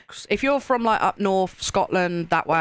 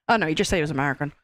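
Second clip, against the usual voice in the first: ordinary delivery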